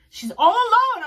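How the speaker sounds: high, loud, amazed exclamation; amazed realization. Retold or imitated. Fan spotting [name] by herself, amazed. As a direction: exclaim it in high-pitched disbelief.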